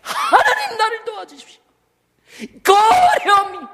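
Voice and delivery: loud, high desperate shout; pleading shout, voice cracking with laughter; crying out to God. Shown as a demonstration. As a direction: cry out to God with everything you have. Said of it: "소리 지르는 거예요".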